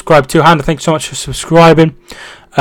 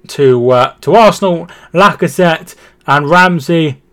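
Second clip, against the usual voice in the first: raised, measured football play-by-play; replay commentary; 4 semitones higher, 4 dB louder than usual. Pretend. Streamer recapping Arsenal's goal for viewers, football commentary. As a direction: talk through the goal move, settling at the end.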